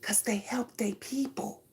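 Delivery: breathy whispered aside. Real, not acted. Telling her audience about loyalty, whispered. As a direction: drop to a bare whisper, like a secret.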